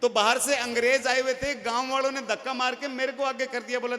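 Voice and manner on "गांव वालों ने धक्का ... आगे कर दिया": ordinary delivery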